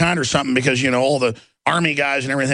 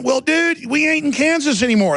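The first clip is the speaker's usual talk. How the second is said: mocking retort; 11 semitones higher than usual. Retold retort to biologist.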